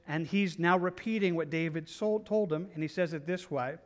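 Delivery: ordinary talk, nothing marked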